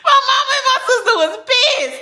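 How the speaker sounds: loud, very high, laughing through it; cracking up while telling it; high laughing shout; laughing exclaim. Real, not acted. Laughing shout about family.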